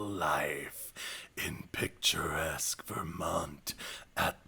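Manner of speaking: whispered movie trailer narration. Pretend. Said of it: "My trailer voice".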